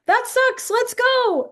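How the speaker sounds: mock impatient parent; dismissive parent. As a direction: hurry the kid along.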